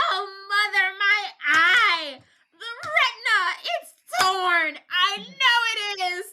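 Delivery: put-on very high, whiny mama's boy; hammed-up crying man-baby voice; wailing in pain. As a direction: wail to mother like a hurt child. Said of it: "He is hurling in pain".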